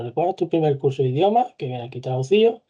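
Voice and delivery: ordinary talk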